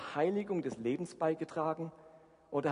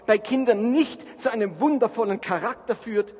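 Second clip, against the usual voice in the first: preaching at full force; 5 semitones higher, 8 dB louder than usual. Acted. Preacher builds to a climax.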